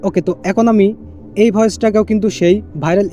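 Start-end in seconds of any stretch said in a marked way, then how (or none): none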